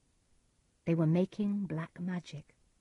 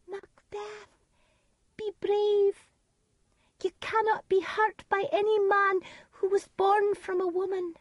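put-on much higher child's voice; child ghost voice. A narrator's reading. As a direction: speak as a bloody child ghost. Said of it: "It said (child ghost)".

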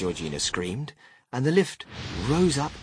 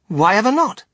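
high, loud incredulous question; loud, raised-pitch disbelief; surprised demand; 11 semitones higher, 9 dB louder than usual. A narrator's reading. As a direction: shoot the question back, amazed. Wonka surprised by the objection.

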